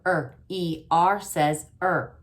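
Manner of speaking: usual speaking voice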